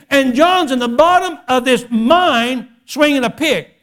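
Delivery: loud, high-pitched impassioned sermon delivery; full-force preaching. Acted.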